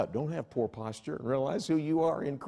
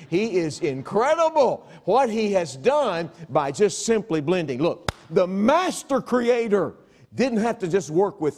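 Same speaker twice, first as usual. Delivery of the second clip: full-force preaching; 8 semitones higher, 7 dB louder than usual. Real, not acted.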